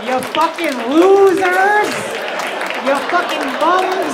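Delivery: loud, slow yelled insults; mock rant yell. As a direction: bellow each insult slowly at them.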